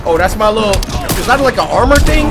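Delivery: ordinary delivery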